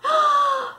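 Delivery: loud high blowing puff; a big blow of breath; puffing blast. Shown as a demonstration. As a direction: puff with all your might. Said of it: "here's your part".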